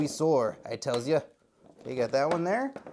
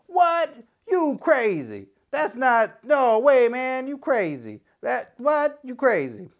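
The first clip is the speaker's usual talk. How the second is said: imagined skeptical viewer; 6 semitones higher, slower than usual. Pretend. Viewer scoffing at the unboxing, mock disbelief. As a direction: play a doubting viewer scoffing. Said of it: "Maybe you're sitting there watching like".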